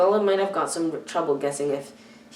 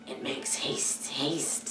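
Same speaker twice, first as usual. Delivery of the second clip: slow whispered hiss, lower and soft; Gollum creature voice; 3 semitones lower, 9 dB quieter, much slower than usual. A narrator's reading. Gollum tells Bilbo to hurry, slow whisper. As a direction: drag it out like a hungry creature.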